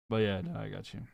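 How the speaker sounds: ordinary talk, nothing marked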